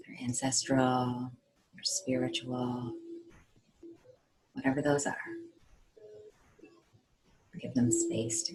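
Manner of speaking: measured meditation voice with long pauses; guided meditation. Acted. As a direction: guide them calmly, phrase by phrase.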